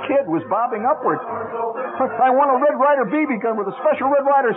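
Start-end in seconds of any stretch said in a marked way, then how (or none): 1.2-4.5 shouted. A narrator's reading. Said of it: "I shouted".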